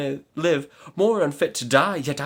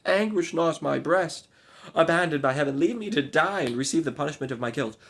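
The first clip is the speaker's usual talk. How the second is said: high, loud despairing pleas; despairing cry; 7 semitones higher, 4 dB louder than usual. Acted. Maid begging the knight to leave her to die, despairing. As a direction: push him away in grief. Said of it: "she cried".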